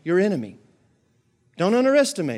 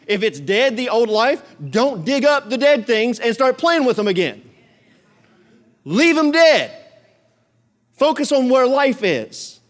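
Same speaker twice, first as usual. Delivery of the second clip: preaching at full force; 6 semitones higher, 4 dB louder than usual. Acted. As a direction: preach it out to the congregation. Preaching about dead things.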